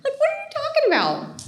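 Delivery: incredulous friend. Retold or imitated. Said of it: "she was like".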